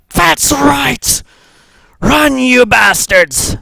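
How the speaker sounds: high, loud yell, slow on the last part; a hammed-up fighter's victory shout, high and loud; triumphant battle cry. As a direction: roar it out at fleeing enemies. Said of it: "she's like".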